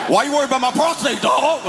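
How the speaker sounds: high, fast, shouted panic; freaking out. Retold or imitated. Friend freaking out at the prostate call, high fast shout.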